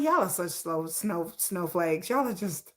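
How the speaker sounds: low, soft mock whine; mocking whiny fans. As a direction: mimic whiny snowflakes. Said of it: "I said".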